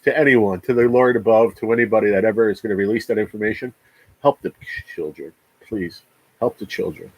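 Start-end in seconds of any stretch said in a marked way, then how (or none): 4.2-7.1 quiet plea. Real, not acted. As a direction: beg gently, voice sinking much softer. Pleading for sick children.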